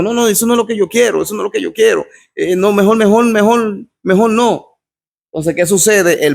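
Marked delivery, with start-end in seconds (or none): 0.0-4.7 higher-pitched, mildly alarmed refusal; retold fright. Foreigner refusing the sorcerer's offer to kill, mildly frightened retelling. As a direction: imitate someone backing out, higher and repeating in alarm. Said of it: "el extranjero se asustó y le dijo".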